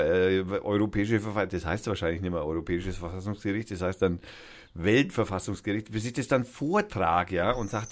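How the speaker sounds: ordinary talk, nothing marked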